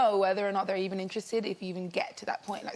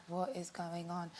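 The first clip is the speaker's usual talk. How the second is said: hushed bewilderment; 3 semitones lower, 12 dB quieter, slower than usual. Retold or imitated. Stunned aside in retold story.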